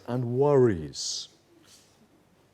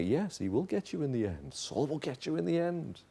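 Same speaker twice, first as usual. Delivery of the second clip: devil's insinuation; 8 dB quieter than usual. Acted. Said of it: "maybe the devil's there also saying it".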